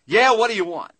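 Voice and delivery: loud, high, surly shout from the back; a gruff clerk who can't be bothered; rude clerk. Retold or imitated. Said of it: "this voice comes back from the back".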